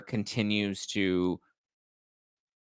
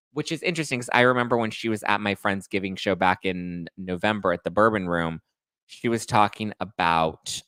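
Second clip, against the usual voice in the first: ordinary talk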